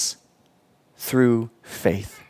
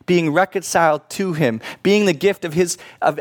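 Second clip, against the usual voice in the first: ordinary talk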